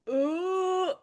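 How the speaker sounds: high cringing "ooh", soft; cringe ooh. Real, not acted. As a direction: wince at the awkward sell. Cringing at the sell.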